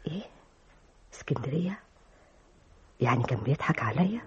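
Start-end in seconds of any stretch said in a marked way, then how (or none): 0.1-1.8 a breathless, shocked whisper of the word; stunned whisper. Acted. Secretary realizing her boss lied, stunned whisper. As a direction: breathe it out in disbelief.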